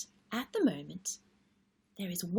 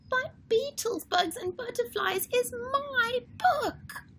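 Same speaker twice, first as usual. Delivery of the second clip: put-on high wailing little girl, near tears; whiny upset Lola. Lola protesting to Charlie at the library, near tears. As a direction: wail that it's hers. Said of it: "Lola says".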